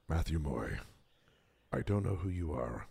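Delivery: very low, hushed action-star impression; deep and soft, long pauses between phrases; Liam Neeson. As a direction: drop way down into a quiet, measured menace. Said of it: "That was my Liam Neeson impression".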